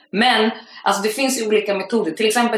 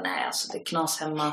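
quiet, put-on gloomy voice, much softer; fake sad; 3 semitones lower, 10 dB quieter than usual. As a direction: play sad to win sympathy. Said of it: "Skådespeleriet". (spelat ledsen, mycket tystare)

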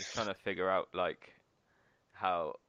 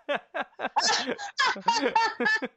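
high, loud burst of laughter; 9 dB louder than usual. Real, not acted. Big laugh.

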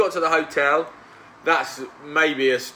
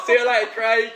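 mock cheery farewell; 9 semitones higher, 4 dB louder than usual. Mocking the grumpy hotel worker.

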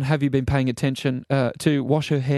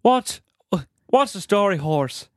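put-on Irish lilt dropping at the end; Irish accent; slower than usual. Shown as a demonstration. Co-host's last quiz accent, Irish accent. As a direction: try an Irish accent on the greeting. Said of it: "Irish, Irish".

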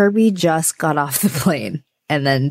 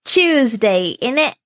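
put-on higher-pitched jokey British accent; mock British accent; 6 semitones higher, slower than usual. Friend teasing about attempted British accents, mock British. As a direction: tease with a put-on British lilt. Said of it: "you've attempted a British accent".

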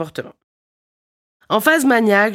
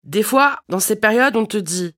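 normal talking voice

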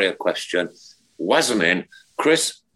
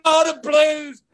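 high excited cry of Oh, the Blues; slow high cry of joy from the bloke; excited fan cry; 5 dB louder, slower than usual. Retold or imitated. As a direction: squeal it like a delighted fan. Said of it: "he went".

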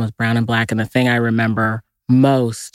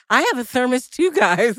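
put-on hopeful kid voice; 11 semitones higher than usual. Child self quoted at lunch.

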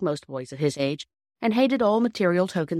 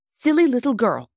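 loud, high self-reproach; self-scolding; 8 semitones higher, 7 dB louder than usual. A narrator's reading. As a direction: scold yourself out loud, pitched up. Scolding herself in narrated story.

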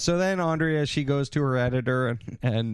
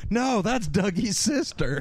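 mock David; 5 semitones higher than usual. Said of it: "David's like".

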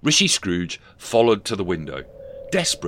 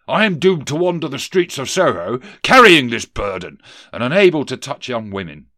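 raised, loud ghostly lament; wailing lament; 5 semitones higher, 7 dB louder than usual. Acted. Doomed ghost bemoans his burden, in a raised wail. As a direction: lament it aloud, swelling on the key words. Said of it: "Johnson Marley wailed".

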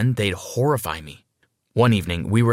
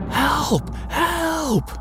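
screamed for help. A narrator's reading. Mother calling for rescue from the bedroom, screaming. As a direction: cry out in a high panicked voice. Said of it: "Emily screamed".

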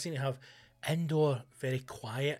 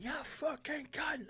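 slow whispered insult; mock rage out the window; 13 semitones higher, 8 dB quieter, slower than usual. Comedian with the window down at a driver, slow whispered rage. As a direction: lean out and hiss it slowly. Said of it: "window down".

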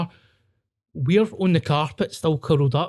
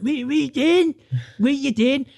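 far higher, frantic mock question; panicked high question; 11 semitones higher than usual. Curled-up friends ask the screaming girlfriend, high frantic question. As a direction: squeak it out in a stoned panic.

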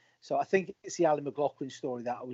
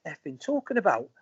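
Macca's outburst; 9 semitones higher, 3 dB louder than usual. Retold or imitated. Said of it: "and he says".

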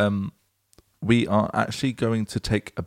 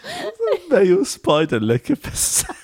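laughing; 14 semitones higher than usual. Real, not acted. Repeats line while laughing.

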